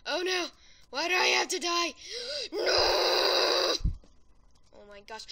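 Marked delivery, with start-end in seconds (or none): 2.5-3.7 anguished cry. Real, not acted. Dying in game.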